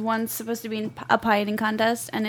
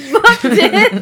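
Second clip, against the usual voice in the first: booked it through a laugh; 8 semitones higher, 8 dB louder than usual. Acted. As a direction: laugh out the words. Echoing the joke, laughing.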